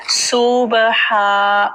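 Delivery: सुबहा drawn out, b and h distinct; slow model सुबहा, syllable by syllable; clear "ba-haa". Shown as a demonstration. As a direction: stretch the "haa" at the end of सुबहा. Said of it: "पढ़ना है".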